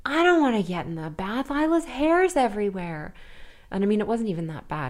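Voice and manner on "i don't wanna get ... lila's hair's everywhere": put-on higher, louder whining boy; imitating a whiny little brother. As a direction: complain like a grossed-out little boy. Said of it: "he's like".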